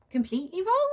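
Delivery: high, loud mock disbelief; incredulous. Real, not acted. Vlogger scoffing at the book's wording, in high mock disbelief. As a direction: scoff at the word "wrong".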